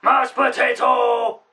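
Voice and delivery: high, loud, drawn-out playful yell; silly shout. Pretend. Friend bursts out with a nonsense catchphrase, silly shout. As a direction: bellow it slowly as a daft catchphrase.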